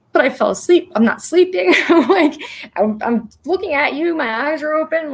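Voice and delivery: high, emphatic protest; exasperated insisting. Retold or imitated. Telling her friend she really fell asleep, high emphatic protest.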